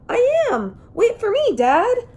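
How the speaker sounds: high, loud little dinosaur calling after his dad; baby T. rex shouting after his father, high and eager. A narrator's reading. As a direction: pipe up high and loud, running to keep up. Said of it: "said the little dinosaur".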